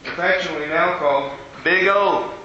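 ordinary talk

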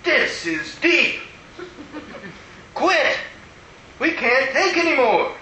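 slow, high, overwhelmed mutter; a lady pleading for it to stop. Retold or imitated. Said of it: "one lady muttered".

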